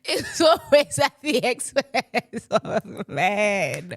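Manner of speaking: drawn-out words through real laughter; helpless, laughing delivery. Real, not acted. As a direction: repeat it slowly while cracking up. Repeats own remark while laughing.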